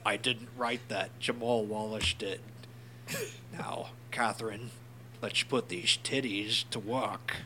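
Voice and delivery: slow, low-key mock Bane; lightly voiced villain, dragging each phrase; a touch of Bane, slow and soft; Bane impression. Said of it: "That sounded better, didn't it?".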